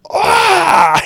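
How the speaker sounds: high loud imitated angry growl-shout; kid's rage growl. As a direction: let out a toddler's frustrated rage yell. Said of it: "that rage growl thing that he does".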